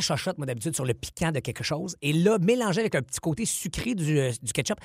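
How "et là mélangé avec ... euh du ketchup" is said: ordinary talk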